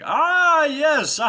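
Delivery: high, loud hearty fake guffaw; clueless laughing along. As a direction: laugh along without getting the joke. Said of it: "but he keeps laughing".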